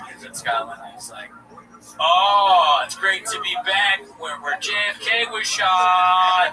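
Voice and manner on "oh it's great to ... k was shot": loud, higher mock Scottish accent, slowing at the end; hammed-up Scottish comedian. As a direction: play a comic botching a Scottish accent. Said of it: "if he was in Scotland".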